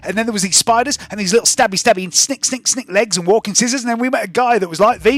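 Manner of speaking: put-on high, loud, rapid babbling kid voice; excitable girl Spring. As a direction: gush breathlessly like an over-eager street kid.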